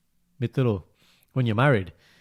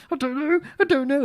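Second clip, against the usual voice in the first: very high, fretful mock voice; mock panicked wife. Husband mocking his tense wife at dinner, high and fretful. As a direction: squeak it out like a flustered wife. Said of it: "she's like".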